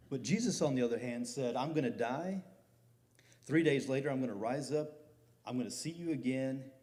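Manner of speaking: loud, rising proclamation of Jesus' promise; full-force preaching. Acted. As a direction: hammer the promise home to the congregation.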